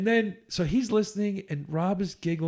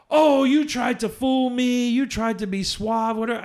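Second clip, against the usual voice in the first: higher, louder, slow teasing imitation of her; mock accusing woman; 7 semitones higher, 6 dB louder than usual. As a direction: play her as if she caught him out. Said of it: "She goes".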